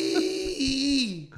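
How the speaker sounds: calling out. Retold or imitated. Beaten Elvis calling for help.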